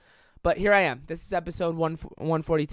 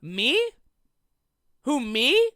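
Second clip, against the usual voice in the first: imitating startled friend; 7 semitones higher, 7 dB louder, much slower than usual. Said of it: "he went".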